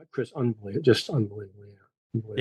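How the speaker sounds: very soft, low-energy deadpan; hushed, drily unexcited reply; mock underwhelmed enthusiasm. Real, not acted. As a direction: murmur the praise as if barely bothered. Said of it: "enthusiasm in your voice".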